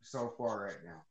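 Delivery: far from the mic; faint, off-mic voice; barely audible. Real, not acted. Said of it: "I can barely hear you".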